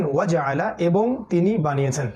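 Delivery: ordinary talk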